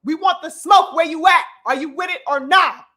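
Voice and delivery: loud, swaggering call for the smoke; mock challenge. Mocking the crew that comes calling for the fight, loud and swaggering. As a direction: bark it out like a crew circling the block. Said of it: "circling the block like".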